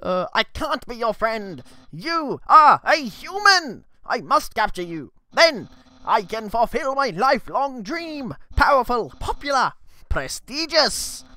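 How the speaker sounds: loud, proud skeleton dreaming of glory; Papyrus voice. Acted. Papyrus proclaims his dream to the human, loud and proud. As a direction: boom out the titles, slow and grand. Reads Papyrus dialogue aloud.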